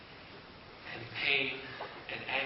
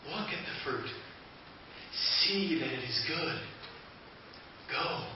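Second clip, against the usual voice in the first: softer and slower, coaxing; tempting; 7 dB quieter than usual. Acted. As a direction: draw her in quietly, almost breathless. Serpent tempting Eve.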